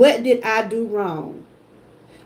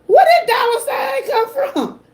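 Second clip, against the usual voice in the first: much higher, amazed exclamation with a laugh-cry; stunned disbelief; 12 semitones higher than usual. Retold or imitated. Streamer retells first seeing the dollar sign, in high amazed disbelief. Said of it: "I said".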